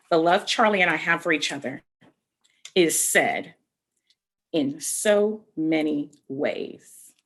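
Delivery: put-on slow, gentle read-aloud to children; storybook reading. A narrator's reading. Teacher reading a picture book to young children, slow gentle storybook narration.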